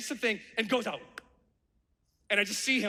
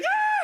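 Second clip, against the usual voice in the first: shrill loud scream; high terrified scream; 6 dB louder than usual. Retold or imitated. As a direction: scream at the top of your voice. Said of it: "I'm screaming".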